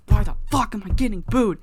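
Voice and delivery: acted outraged shout, high and loud; hammed-up fury, punched word by word; furious yell. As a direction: yell it like a wrestler fed up with the crowd. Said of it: "he's just furious".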